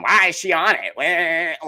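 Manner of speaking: whiny imitation of online complainers; mock whining. Podcaster mimics angry commenters on a meme, mock whining.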